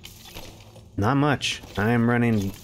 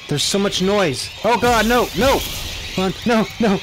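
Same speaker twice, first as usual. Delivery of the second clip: high, loud frantic yelling; panicking; 9 semitones higher, 5 dB louder than usual. Real, not acted. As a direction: scream it as enemies swarm you. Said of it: "I'm freaking, I'm panicking".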